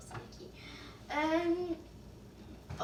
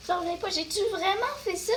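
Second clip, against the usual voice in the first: high, loud disbelief; can't believe she did that; 7 semitones higher, 4 dB louder than usual. Real, not acted. Disbelief at the mess.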